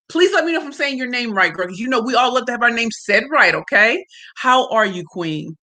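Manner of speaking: usual speaking voice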